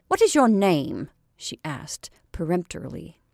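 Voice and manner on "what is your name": peremptory, louder question; aggressive demand. Acted. Lottie peremptorily demands the girl's name, aggressive. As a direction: demand her name like an order. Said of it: "Lottie began to be aggressive".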